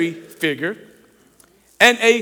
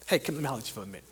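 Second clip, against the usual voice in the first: hushed aside; 10 semitones lower, 17 dB quieter, much faster than usual. Acted. Peter pulls Jesus aside.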